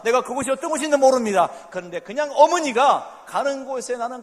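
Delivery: loud, high full-force sermon; fiery preaching. Acted. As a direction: preach it with everything. (목청 높여 힘차게 설교하듯)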